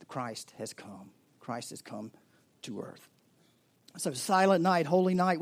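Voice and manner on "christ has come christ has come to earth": hushed. Real, not acted. Hushed climax of sermon.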